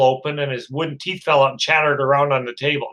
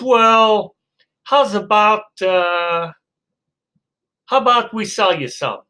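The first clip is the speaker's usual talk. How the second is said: sly salesman; 6 semitones higher than usual. Acted. Gun seller offers a deal.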